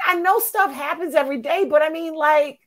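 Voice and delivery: high, whiny complaint; frustrated whine. Retold or imitated. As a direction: complain like a fed-up patient. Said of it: "I was like".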